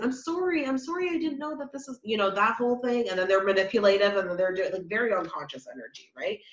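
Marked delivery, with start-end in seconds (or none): 0.0-2.0 higher, pleading sorry on repeat; over-apologize like a people-pleaser; high mock apology. Karmic apologizing to the masculine, high mock apology.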